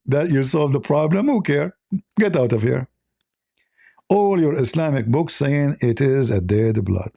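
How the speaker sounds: normal talking voice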